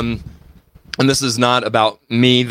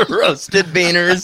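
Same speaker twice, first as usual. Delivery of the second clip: laughing; 7 semitones higher, slower than usual. Pretend. Laughing repeat.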